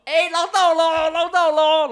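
high, loud drawn-out call; long ringing cry to the whole boat; triumphant shout. Retold or imitated. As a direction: holler it out like a boat skipper announcing a catch. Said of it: "然后之后大喊说".

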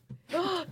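sharp hammed-up gasp of shock; playful high gasp; mock gasp. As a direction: gasp as if wounded by the refusal. Shocked at being refused.

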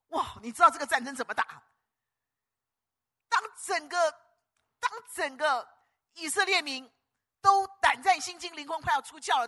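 loud, high battle-story sermon; thundering storytelling from the pulpit; full-force preaching. Acted. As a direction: drive the tale of David charging out.

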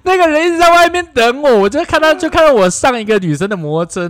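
high, loud laughing words. Real, not acted. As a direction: tell it through laughter. Laughing retelling of escape.

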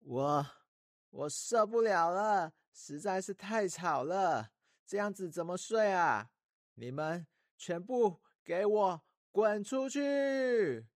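put-on high, fed-up little girl yelling; a princess at her wits' end; exasperated outburst. A narrator's reading. Princess fed up with noisy animals.

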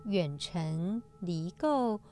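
slow, measured scripture reading; sutra recitation. Acted. Host reciting today's sutra passage to listeners, solemn recitation.

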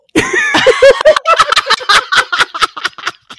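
cracking up; loud laughter. Real, not acted. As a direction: burst of real laughter. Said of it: "laughing at partner".

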